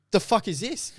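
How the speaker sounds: high, incredulous exclamation; mock disbelief. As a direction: sound floored by what you see. Retold shocked reaction.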